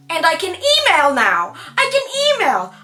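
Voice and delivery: high, loud little cheer; proud squeal of achievement; excited brag. Real, not acted. Bragging to viewers about emailing, excited. Celebrating small wins.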